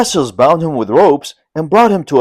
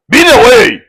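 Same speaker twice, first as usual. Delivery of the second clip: loud, high defiant yell; defiant cry; 9 semitones higher, 8 dB louder than usual. A narrator's reading. Lin Chong defies the vassals beating him, loud cry. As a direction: hurl it back at the men beating you. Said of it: "he cried".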